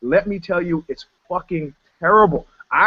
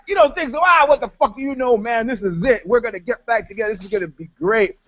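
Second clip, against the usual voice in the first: much higher, defiant scoff; scoffing retort; 5 semitones higher than usual. Retold or imitated. Answering the bass player's doubt, mock indignant high scoff. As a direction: brush him off, sure of yourself. Dismissive retort in retold story.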